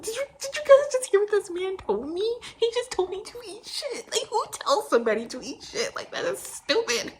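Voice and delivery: very high-pitched mock outrage; freaking out, acted. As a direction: squeal in disbelief, hammed up. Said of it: "freaking out to my pilots".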